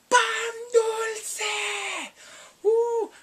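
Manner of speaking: high, loud cry of joy, slowing on conchas; delighted shout. Real, not acted. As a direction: shout it like you spotted your favourite treat. Excited at pastries on screen.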